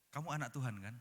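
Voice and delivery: ordinary delivery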